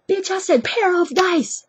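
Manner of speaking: loud, high, slow furious mock yell; outraged correction. Pimp correcting his misheard name, imitated outraged shout. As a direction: hammer out "pair of dice" word by word.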